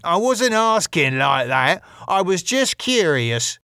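high, put-upon denial; defensive protest. Acted. Wayne defends himself.

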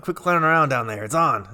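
Brian Burke. Acted. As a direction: lightly mimic a boss calling down to someone. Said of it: "that's my no effort Brian Burke".

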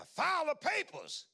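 high, loud, slow booming command; voice of the Lord. Retold or imitated. The Lord commanding the preacher to file, booming loud and slow. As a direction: boom it out like God giving an order. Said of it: "I heard the voice of the Lord say".

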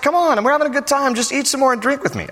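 high, quick mock coaxing; coaxing father-in-law. Father-in-law presses his guest to stay, coaxing.

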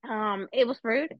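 ordinary delivery

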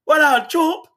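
high, breezy Jamaican-style "What up, Chop?". Retold or imitated. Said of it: "him like".

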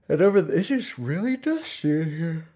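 talking through a real yawn. Real, not acted. Sleepy host yawning while making an excuse. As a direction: let a yawn pull the words up and open. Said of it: "pretend that's why I'm yawning again".

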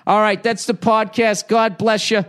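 high, loud cheerful farewell; upbeat podcast goodbye; bright sign-off. Real, not acted. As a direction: wrap it up with a lift. Signing off, still playful.

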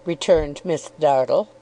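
ordinary delivery, nothing marked

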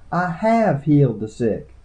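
high, slow indignant insistence; mildly heated, high-pitched protest; hot retort. A narrator's reading. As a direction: insist hotly, drawing the words out. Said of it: "she said hotly".

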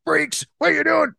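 high mock outrage at dog owners; mock angry Joey rant. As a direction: rant like a disgusted restaurant patron. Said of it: "Joey's like".

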